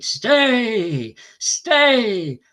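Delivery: loud, high, drawn-out shout; incensed cry. A narrator's reading. Bhishma answers the challenge, incensed cry. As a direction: cry it out with force, pitch falling away. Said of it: "was incensed and cried".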